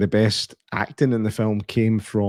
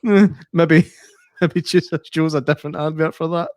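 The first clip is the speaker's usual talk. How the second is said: amused disbelief; 6 semitones higher than usual. Retold or imitated. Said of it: "I was like".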